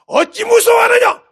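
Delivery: high, drawn-out shouted rebuke; rebuking shout. Acted. Preacher as Jesus challenging fearful disciples, rebuking shout. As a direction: shout it slowly, full of reproach. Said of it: "내 자식, 내 제자들 보고".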